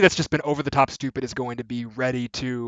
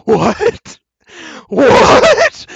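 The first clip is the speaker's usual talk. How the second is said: high, loud incredulous what, laughing on the second; shocked disbelief; 12 semitones higher, 11 dB louder than usual. Retold or imitated. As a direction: squeak out the disbelief, cracking into a laugh. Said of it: "you're just like".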